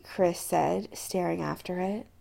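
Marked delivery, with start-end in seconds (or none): none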